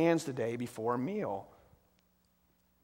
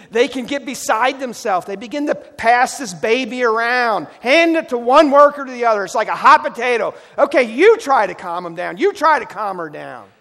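loud, higher, fast frantic telling; frantic rush; 8 semitones higher, 13 dB louder than usual. Real, not acted. Pastor acting out frantic nursery workers, loud and hurried. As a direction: toss it like a hot potato.

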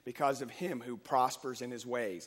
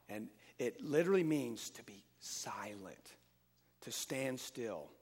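whispered; 3 semitones lower, 4 dB quieter than usual. Acted. Preacher to his church, whispering what silent means.

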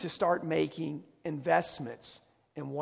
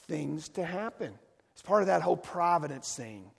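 preaching at full force; 5 dB louder than usual. Acted. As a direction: hammer each "when we give" home. Climax on giving.

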